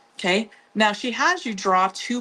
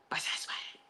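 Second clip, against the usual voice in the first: hushed, voiceless whispered credit; 13 dB quieter than usual. Real, not acted. Host credits the pattern maker to viewers, whispered. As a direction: whisper it as a soft tag-on.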